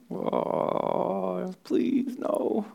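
high, breathy whispered plea; anguished whisper. Acted. Preacher voicing a parent dreading a child's path, high whispered plea. Acted dread over a life.

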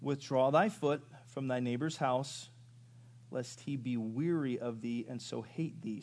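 slow, deliberate reading of the verse; slow scripture reading. Shown as a demonstration. Preacher reading the verse aloud to his flock, slow. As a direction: lay out each word of scripture slowly. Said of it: "Circle the word weary".

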